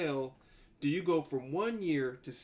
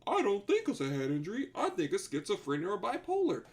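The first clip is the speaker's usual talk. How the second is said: higher, mocking know-it-all doctor; 6 semitones higher than usual. Streamer mocking the prison doctor's diagnosis, in a high mock doctor voice. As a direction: sneer it like a clueless doctor. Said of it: "And this doctor says".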